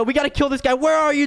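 normal talking voice

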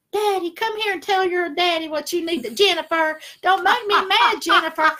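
high, loud campy diva warning; hammed-up high sing-song threat; son's daddy voice. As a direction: play the sassy daddy scolding Jennifer. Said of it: "he calls himself".

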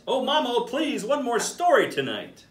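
little monkey pleading for a story; begging little monkey. Acted. Monkey begs mama for story.

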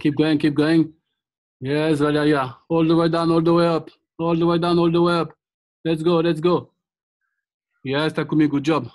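loud, driving class encouragement; loud coaching. Real, not acted. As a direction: pump the class up loudly. Coach pushing the squat class.

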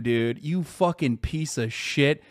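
usual speaking voice

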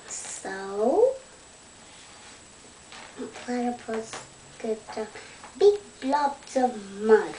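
plain reading voice

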